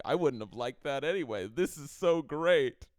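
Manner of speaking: high, loud mock gushing; hammed-up happy-ending girlfriend; delighted girlfriend.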